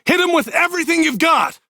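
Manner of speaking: high, fast battle order; shouted. A narrator's reading. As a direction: urge them on, fast. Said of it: "Jay shouted".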